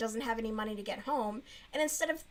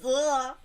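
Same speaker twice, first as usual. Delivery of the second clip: loud grossed-out ugh; disgusted ugh; 7 dB louder than usual. Real, not acted. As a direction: groan of disgust. Disgust at the book.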